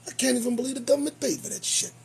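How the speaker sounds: normal talking voice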